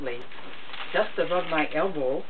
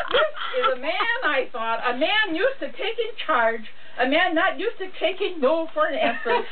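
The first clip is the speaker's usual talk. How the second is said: laughing while talking; 9 semitones higher than usual. Real, not acted. Reading through laughter.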